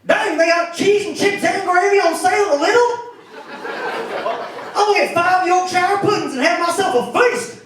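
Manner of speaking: high, loud Alabama yokel; Alabama accent. Acted. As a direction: holler it in a broad Alabama accent. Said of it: "like being from Alabama in America".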